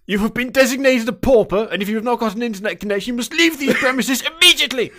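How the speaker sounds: high, loud, officious Victorian English dismissal; pompous ye olde English ejection, building to a laughing finish; Victorian English gentleman. Acted. Salesman throwing out a pauper, hammed-up Victorian English. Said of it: "a ye olde English Victorian-y style".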